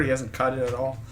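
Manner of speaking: ordinary talk, nothing marked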